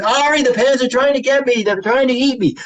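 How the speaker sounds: high, hammed-up fake panic; dad playing scared of the pants to make his daughter laugh; mock alarm. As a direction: pretend the pants are about to eat you. Dad pretending pants attack.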